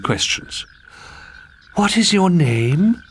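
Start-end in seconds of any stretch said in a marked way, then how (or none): 1.7-3.0 put-on high, slow, girlish questioning; sweet princess voice. Princess asking a frog its name, high and slow. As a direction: coax the frog gently like a dim princess.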